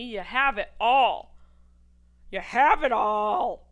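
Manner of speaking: "all" drawn out slow and loud; slow loud boast. Real, not acted. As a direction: savor the line, slow and booming. Emphatic repeated punchline.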